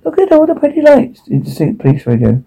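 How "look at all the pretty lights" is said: very high-pitched character voice; distorted Poker. A narrator's reading. Poker repeating the pretty lights line, very high distorted voice.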